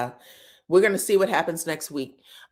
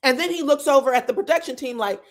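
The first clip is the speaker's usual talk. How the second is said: normal talking voice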